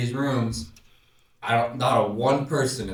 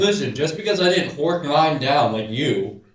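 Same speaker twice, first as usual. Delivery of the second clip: louder, chewing while talking; mouth full; 6 semitones higher, 8 dB louder, faster than usual. Real, not acted. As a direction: protest through a mouthful of pretzel. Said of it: "talking with your mouth full".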